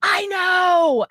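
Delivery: high, loud whiny retort; imitating loud girls. Girls answering mom's shushing, loud whine. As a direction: shout it back like an annoyed kid. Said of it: "They're just normal volume".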